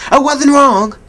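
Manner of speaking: high, loud insistence; indignant. Acted. Defiant repeat.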